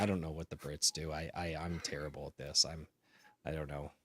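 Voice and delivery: ordinary delivery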